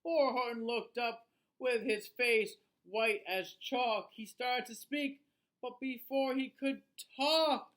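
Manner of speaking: put-on lively, sing-song read-aloud for children; storybook reading. A narrator's reading. Reading a rhyming book to children, lively storyteller voice. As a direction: read it like bedtime story time.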